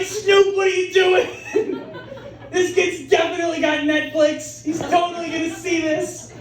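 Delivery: high-pitched mock-incredulous objection; alarmed protest. Acted alarm at [name]'s remark.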